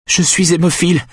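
high-pitched, pleading moan; gémissement. A narrator's reading. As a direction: moan it as a frightened plea. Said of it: "gémit Benjamin". (gémissement aigu et suppliant)

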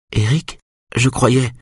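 a lightly voiced disappointed Maya; hurt, trailing off. A narrator's reading. Maya to Eric after being rejected, slow and hurt.

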